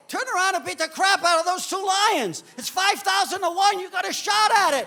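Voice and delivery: very high, loud yell; yelling at the screen. Retold or imitated. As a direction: shout at the TV to fight back. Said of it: "you start yelling at the screen".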